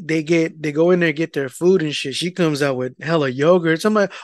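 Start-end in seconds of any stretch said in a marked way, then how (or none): none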